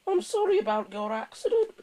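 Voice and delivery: very high, loud character voice; muttered apology. A narrator's reading. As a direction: apologise awkwardly in a squeaky engine voice. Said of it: "he muttered".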